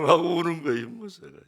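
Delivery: choked crying. Real, not acted. Pastor tells of father crying.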